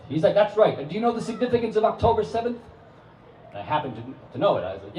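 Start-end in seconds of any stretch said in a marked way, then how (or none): 0.4-2.6 eccentric visitor. Retold or imitated. Visitor lecturing narrator.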